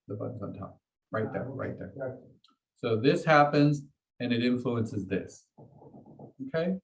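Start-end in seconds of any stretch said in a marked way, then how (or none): 0.1-2.2 softer, under the breath; quiet repeat. Shown as a demonstration. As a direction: say "The button's on top" again quietly as an aside.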